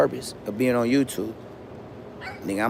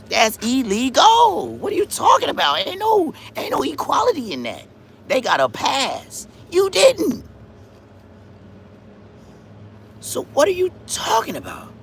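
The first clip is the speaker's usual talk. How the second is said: indignant rant; 13 semitones higher than usual. Real, not acted. Ranting at illegal immigrants, high indignant outrage. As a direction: demand it with rising outrage.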